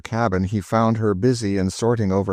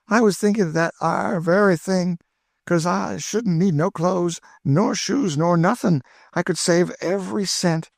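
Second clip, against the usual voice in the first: put-on much higher, thrilled old cook; Aunt Chloe delighted; 10 semitones higher than usual. A narrator's reading. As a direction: burst with joy, high and eager. Chloe voiced by the narrator.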